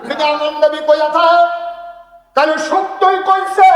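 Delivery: high, full-force proclamation; impassioned shout. Acted. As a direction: shout it out, stretching the ending. Avowal repeated at full force.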